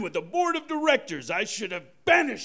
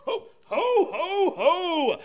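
jolly ho ho ho; 8 semitones higher, much slower than usual. Acted. Santa's jolly laugh.

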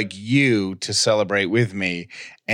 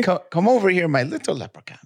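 high, playful mock-Irish coaxing; attempted Irish accent; 8 semitones higher, faster than usual. Host goading laughing co-host, silly put-on Irish accent. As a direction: pitch it up into a silly Irish lilt. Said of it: "in your best Irish accent".

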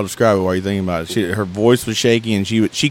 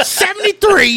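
mock yell. Imitating his friend screaming codes at the neighbor, hammed-up shout. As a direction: yell the code number like a raving cop. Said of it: "I remember you yelling".

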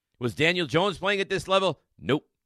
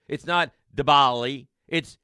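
ordinary talk

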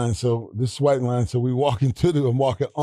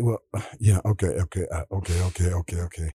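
little stutter; 7 semitones lower, faster than usual. Retold or imitated. Quarterback reluctantly giving in to his coach, little stutter. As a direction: imitate someone hesitantly giving in, word by word. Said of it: "he had a little stutter in his voice".

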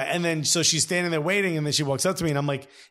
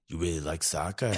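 low, hushed New York woman's voice; New York accent; 11 semitones lower, 7 dB quieter than usual. Retold or imitated. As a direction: imitate a New York mother, low and soft. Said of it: "She's very New York".

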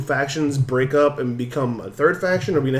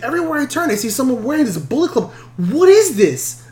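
high, puzzled stranger voice; 10 semitones higher than usual. Retold or imitated. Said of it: "He's like".

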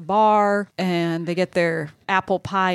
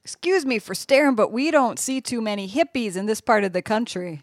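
put-on southern accent; 3 semitones higher than usual. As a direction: play a southern barfly sizing up outsiders. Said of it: "get out that southern accent".

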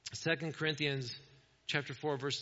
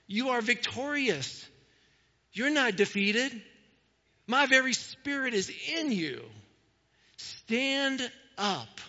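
put-on God urging his children to stand, rising passion; rising exhortation; 5 semitones higher than usual. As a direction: lift them to their feet. Voicing God's encouragement, building.

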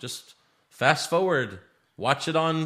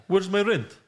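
Mr. Dickovich; 6 semitones higher than usual. Retold or imitated. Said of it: "push Mister Dickovich".

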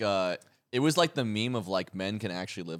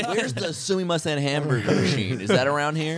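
higher, louder mock American tourist; playful American accent, pitched up; American tourist impression; 6 semitones higher, 4 dB louder than usual. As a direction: ham up a clueless American abroad. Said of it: "I love American impressions".

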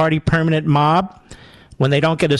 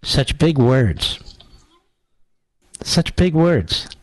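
slow, drawn-out sarcastic wonder; slow mocking admiration; mock awe; much slower than usual. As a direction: pretend to be floored, word by word. Mocks clip's ponderous pronouncement.

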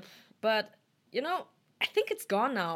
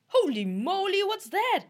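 exaggerated surprise; 11 semitones higher, 4 dB louder than usual. Repeated takes of surprised exclamation.

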